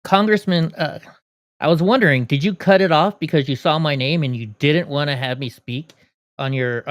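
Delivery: ordinary talk, nothing marked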